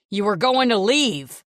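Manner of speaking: loud, raging accusation; furious shout. Acted. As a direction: yell it in drunken rage. Drunk husband rages at wife.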